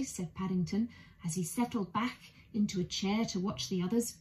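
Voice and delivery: ordinary delivery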